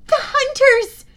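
high, loud excited cry; a girl crying out as the archers appear. A narrator's reading. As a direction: call out the sighting with a jolt. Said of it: "Annabeth cried".

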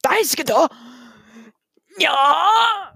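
high squealing anime cat cry; anime catgirl voice. Retold or imitated. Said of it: "that's how they say it in the video".

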